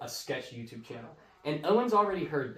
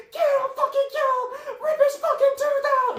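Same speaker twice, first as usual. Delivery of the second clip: high shrieking crowd cheer, much louder; screaming crowd; 10 dB louder than usual. Retold or imitated. Host voices the crowd cheering the fight, shrieking. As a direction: scream like a bloodthirsty schoolyard crowd. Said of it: "They're like".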